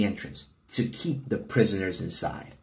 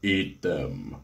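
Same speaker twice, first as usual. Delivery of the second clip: much lower booming monster voice; booming arrogant alien overlord; 9 semitones lower, 3 dB quieter than usual. A narrator's reading. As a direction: rumble it out like a giant beast. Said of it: "a low, booming voice said".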